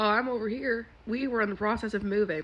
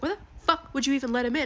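quick, raised mock-angry question; retold furious husband; 5 semitones higher, faster than usual. Husband snapping at his wife over letting a stranger in, quick and mildly heated. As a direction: play the husband as fast and a bit heated. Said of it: "he was very furious with me".

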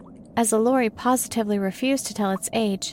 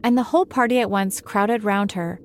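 ordinary delivery, nothing marked

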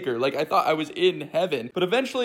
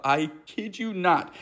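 ordinary delivery

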